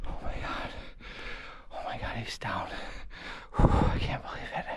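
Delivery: very soft, awed whisper; breathless awe; overwhelmed whisper. Real, not acted. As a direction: hush it out, still in disbelief. Overwhelmed by the buck.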